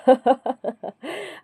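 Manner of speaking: short mocking laugh; scornful laugh. Brother laughing at his sister's school plan, scornful. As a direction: laugh off her request. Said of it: "हंसकर बोला".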